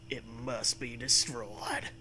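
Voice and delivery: low, soft, slow doom voice; ominous. Acted. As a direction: intone it gravely. Acted doom line.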